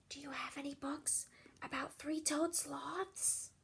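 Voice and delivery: whisper. Acted. Isabella asks the librarian for sloth books, whispering.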